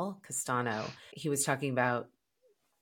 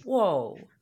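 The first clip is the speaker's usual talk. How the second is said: higher, louder "whoa" of surprise; retold shock; 6 semitones higher, 5 dB louder than usual. Podcaster retelling how her guest was stunned by his date, mock shocked. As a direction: imitate someone taken aback. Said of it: "just shocked".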